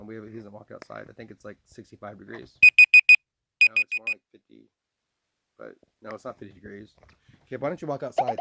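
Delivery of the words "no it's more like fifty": squeaky high voice. Acted. Voice interjects on number.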